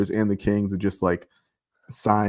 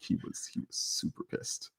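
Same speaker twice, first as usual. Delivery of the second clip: breathy whisper of emphasis; hushed aside about the angry player; 14 dB quieter than usual. Real, not acted. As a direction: whisper it like a secret.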